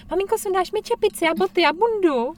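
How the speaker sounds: imitating a lazy kid coming home; lazy child whine. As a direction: beg mom to take it all off.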